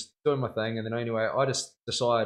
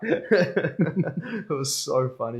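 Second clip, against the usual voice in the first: very slow, words stretched by laughter; real laughter at the memory; laughing; 4 semitones higher, 4 dB louder, much slower than usual. Real, not acted. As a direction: laugh through each word. Cracking up at own story.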